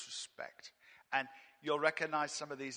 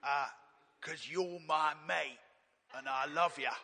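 lightly voiced cockney urchin, a touch louder; a hint of a London street boy pleading to God; mild Dickensian cockney plea; cockney Oliver Twist voice; 3 dB louder than usual. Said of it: "I'm slipping into Oliver Twist now".

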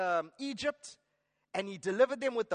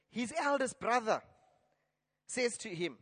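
ordinary talk

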